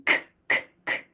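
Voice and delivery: k, k with a puff of air; breathy English k; aspirated k. Shown as a demonstration. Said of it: "Can you feel the difference".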